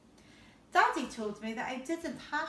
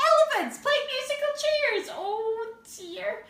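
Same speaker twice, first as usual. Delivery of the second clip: much higher and louder, in disbelief; amazed exclamation; 12 semitones higher, 9 dB louder than usual. Real, not acted. Reader reacting to the story for children, astonished. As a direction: gasp it out in mock horror.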